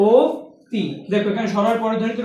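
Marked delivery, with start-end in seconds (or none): 0.1-0.8 অতি with an o sound at the start, louder; ওতি pronunciation. Shown as a demonstration. Teacher showing students the answer, অতি spoken as "oti". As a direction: say অতি as "oti", first vowel rounded to o. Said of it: "এটার উচ্চারণ কি হবে?".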